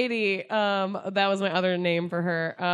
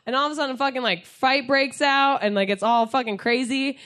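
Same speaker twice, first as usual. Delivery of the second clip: ordinary talk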